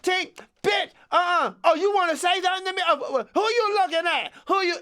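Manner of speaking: put-on high, wailing kid voice; bus kid squabbling. Kid picking a fight on the bus, high and wailing. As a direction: whine and snap like a riled-up kid.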